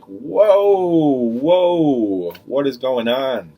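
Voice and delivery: imitating a high, loud, startled whoa; mock shock at the scene. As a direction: firefighters walking into a mess, acted as a high, loud startled whoa. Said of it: "they're just like".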